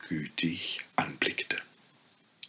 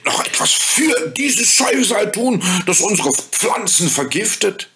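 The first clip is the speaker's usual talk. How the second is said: put-on high, outraged child voice; indignant boy; 12 semitones higher, 4 dB louder than usual. A narrator's reading. Hans protesting to the fairy, in high indignation. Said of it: "rief Hans empört".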